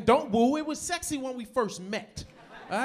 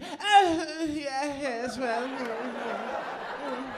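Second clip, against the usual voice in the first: lightly voiced posh British hum, drawn out very slowly; imitating a condescending English wife; mock English wife; 3 semitones higher, much slower than usual. Husband mocking his English wife's condescending sounds, mild British put-on. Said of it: "condescending tone to it".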